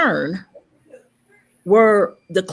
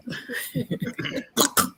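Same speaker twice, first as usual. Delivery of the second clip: high airy laugh; 10 dB quieter than usual. Real, not acted. Laughing at the joke.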